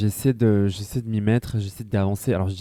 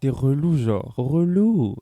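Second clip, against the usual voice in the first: whiny; 4 semitones higher, 6 dB louder, much slower than usual. Real, not acted. Whining that it's annoying.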